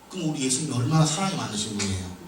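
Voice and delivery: ordinary talk, nothing marked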